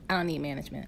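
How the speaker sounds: sassy "I don't need management", leaning into the camera; with attitude. Shown as a demonstration. Wife showing viewers how to say it, with attitude. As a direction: give it diva attitude, up close to the lens. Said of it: "say it with attitude and get close".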